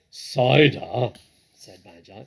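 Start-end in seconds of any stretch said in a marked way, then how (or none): 0.1-1.1 relishing. A narrator's reading. Badger savours cider.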